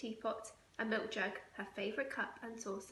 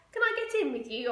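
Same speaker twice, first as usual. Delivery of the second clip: put-on high, loud toddler ask; the youngest begging to join; high-pitched loud little voice; little child; 6 semitones higher, 8 dB louder, faster than usual. Toddler asks mother.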